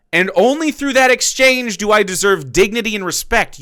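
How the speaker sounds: parroting the worker to ridicule him; mock worker voice. To the viewers, ridiculing wage workers, a sneering mock worker voice.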